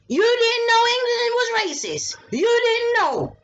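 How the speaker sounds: high, loud accusing challenge; emphatic shout. Real, not acted. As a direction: hammer it home loudly.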